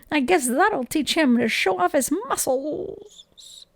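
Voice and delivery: slow, smug cat voice. Acted. Snowball voiced by narrator.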